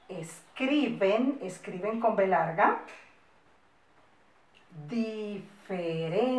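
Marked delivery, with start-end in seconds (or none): 0.1-1.4 word broken into drawn-out syllables; slow dictation. Shown as a demonstration. Teacher dictating, then repeats normally. 4.9-6.2 stretched, separated syllables; slow dictation. Shown as a demonstration. Teacher dictating word slowly.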